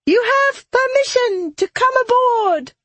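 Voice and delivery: very high, gleeful captain's call; exultant call. Acted. Captain invites the others onto her ship, exultant high call. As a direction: call it out high over your shoulder. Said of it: "casting over her shoulder".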